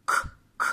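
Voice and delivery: "k" as a bare consonant click, no vowel; isolated phoneme. Shown as a demonstration. Teacher isolating a phoneme for children, "k" as bare short bursts. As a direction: click "k" alone, short and separate. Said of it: "j'entends le phonème".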